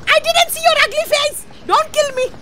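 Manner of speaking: very high, loud plea; panicked squeal. Real, not acted. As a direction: squeal for your life. Panicked at monster in game.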